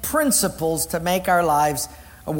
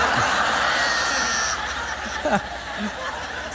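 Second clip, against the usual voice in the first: big laugh; 2 semitones higher than usual. Real, not acted. Audience-style laughter.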